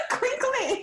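laughing. Real, not acted. Laughing over a silly word.

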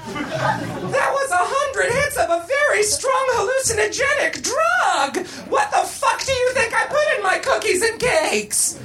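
high, hammed-up outraged screech; furious scolding. A narrator's reading. Missus Garrett explains the drug to Tootie, furious scolding. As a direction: screech it in exasperation, pitch held high.

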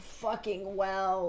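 slow, low exasperated groan; disgusted. Real, not acted. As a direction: drag it out in disgust. Disgust at the well scene.

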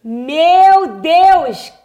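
loud, astonished cry; real delighted shock; amazed exclamation. Real, not acted. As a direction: burst out in amazement. Reacting to millions of views.

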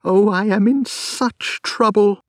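a distraught wife crying out for help. Acted. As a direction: plead close to sobbing. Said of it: "she cried".

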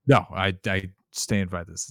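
ordinary delivery

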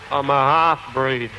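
ordinary delivery

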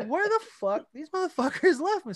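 retold incredulous complaint; indignant disbelief. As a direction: act out being stunned at getting ditched. Acts out discovering he was abandoned.

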